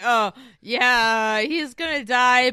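loud, drawn-out show of nonchalance; loud mock-casual reaction; playing it off. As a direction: play it off, a bit too loudly. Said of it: "We were all like".

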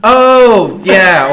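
loud, high "oh" of realization; light up as it clicks; real burst of recognition; sudden recognition. Real, not acted.

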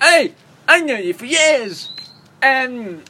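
high, loud hammed-up film impression; Neil. Retelling Neil's speech to a friend, hammed-up high shouting. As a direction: shout it out in a high mock character voice. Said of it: "That's a great impression".

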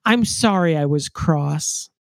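slow, deliberate read of the line; measured quote. Retold or imitated. Reciting a favorite line from the book, slow and measured. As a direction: draw the quote out without raising the pitch. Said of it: "says my favorite line".

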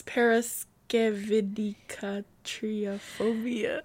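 every syllable of paraskevidicatriophobia slowly; slow careful pronunciation. Shown as a demonstration. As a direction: sound it out syllable by syllable. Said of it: "Oh, you said it right?".

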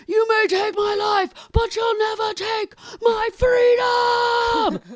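high shouted battle cry; Mel Gibson shout. Retold or imitated. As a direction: yell it like a rallying warrior. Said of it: "and he's like".

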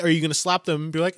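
ordinary talk

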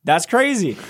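high, astonished oh my gosh; amazed. Retold or imitated. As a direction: lift it up in amazement. Amazed reaction retold.

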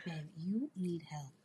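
whispering. Real, not acted. Whispered aside to friend.

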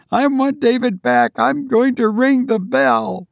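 high and frantic; desperate. Acted. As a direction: fight to break free. Mother struggling free.

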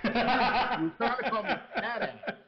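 slow, loud, laughing it out; cracking up while explaining. Real, not acted. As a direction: laughs through the punchline. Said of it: "laughing at own story".

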